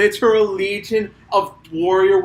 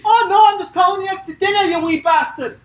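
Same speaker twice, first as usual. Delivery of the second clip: high, fast, shouted Scottish brogue; Scottish accent; 12 semitones higher, 4 dB louder than usual. Pretend. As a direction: yell it in a hammy Scottish accent. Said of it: "they have a Scott off".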